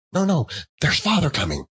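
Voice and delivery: put-on quick, high, eager child's warning; excited children. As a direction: hurry it out like excited kids. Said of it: "cried the two young Cratchits".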